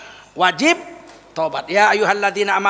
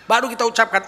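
ordinary talk, nothing marked

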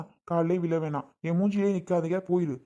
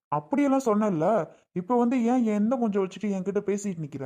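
angry raised voice; 4 semitones higher than usual. Acted. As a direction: fling her own words back at her. Husband throwing wife's words back.

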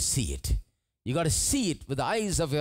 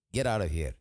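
low mock Pharaoh; 9 semitones lower than usual. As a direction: lower Pharaoh voice, a bit gruff.

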